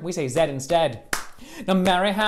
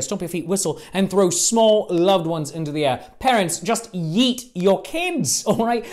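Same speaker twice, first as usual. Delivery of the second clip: ordinary talk, nothing marked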